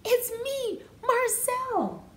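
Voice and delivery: high, bright cry of joy; excited call. A narrator's reading. Goose calling out his own name, high and excited. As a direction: shout the name like a happy discovery.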